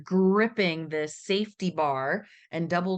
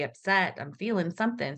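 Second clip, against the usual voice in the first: ordinary talk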